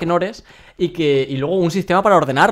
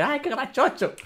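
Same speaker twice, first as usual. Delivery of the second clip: put-on high, soft baby-talk squeak of "grachocho"; mock cute voice; 9 semitones higher, 6 dB quieter, slower than usual. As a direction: coo it like talking to a puppy. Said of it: "esto de".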